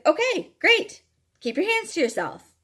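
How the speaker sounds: bright, high, curt "okay, great" then a flat order; sharp fake-cheerful dismissal; sarcastic brush-off. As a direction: cut him off with mock politeness. Telling off a pushy stranger.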